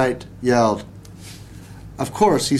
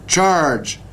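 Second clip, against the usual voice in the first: called out; 5 semitones higher than usual. Acted. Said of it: "he called to Hokey Pokey".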